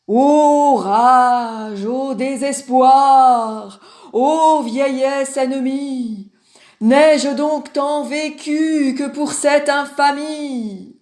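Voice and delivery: loud, drawn-out tragic declaiming; high, slow, grandiose verse delivery; hammed-up declamation. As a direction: declaim like an old tragedian, stretching every vowel. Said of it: "qui se met à déclamer".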